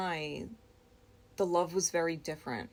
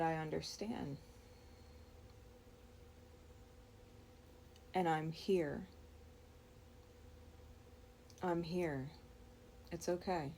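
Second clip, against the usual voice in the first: softer, hushed comforting words; soft reassurance; 10 dB quieter than usual. Real, not acted. Holding the crying man, softly reassuring him. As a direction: soothe a crying friend gently.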